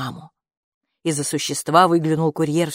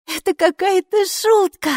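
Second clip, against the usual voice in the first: put-on high, loud childish exclamation; a kid blurting disbelief; excited child; 14 semitones higher, 5 dB louder than usual. A narrator's reading. Child blurts out at the letter, high loud voice.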